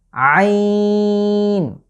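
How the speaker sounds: throaty squeezed আইন held out; Arabic letter ain. Shown as a demonstration. Teacher showing viewers the throat letter, strained long আইন. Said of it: "এর উচ্চারণ হচ্ছে".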